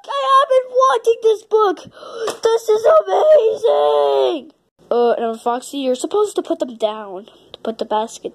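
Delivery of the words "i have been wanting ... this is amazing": high, gushing delight; thrilled. Acted. Character getting Charlotte's Web, thrilled. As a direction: squeal over a wished-for book. Character gets wanted book.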